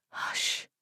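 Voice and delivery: whispered "Hush". A narrator's reading. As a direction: breathe it out to silence him. Edith silencing him.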